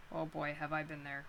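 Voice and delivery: ordinary delivery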